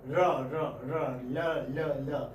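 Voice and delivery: "ra" repeated, then "la", each syllable separate; R against L. Shown as a demonstration. Teacher contrasting R with lateral L, "ra" then "la" in drilled syllables. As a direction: contrast rolled-tongue "ra" with lateral "la". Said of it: "do with R".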